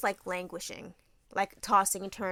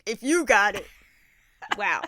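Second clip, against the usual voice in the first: loud excited; 9 dB louder than usual. Retold or imitated. Dad quoted, excited.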